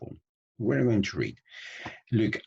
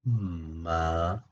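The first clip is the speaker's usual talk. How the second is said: "mother" hugely stretched, long gap before "there"; slow motion. Shown as a demonstration. Teacher demonstrates mouth movement in slow motion, extremely drawn out. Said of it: "En cámara lenta".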